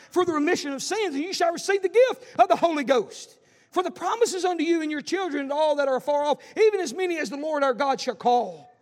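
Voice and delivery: loud, high scripture proclamation; preaching at full force. Acted. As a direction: thunder the verses to the church.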